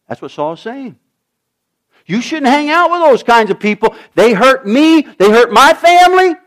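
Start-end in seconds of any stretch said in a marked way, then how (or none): 2.1-6.3 hurt warning father. Acted. Saul voiced warning his son.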